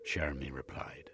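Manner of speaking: plain reading voice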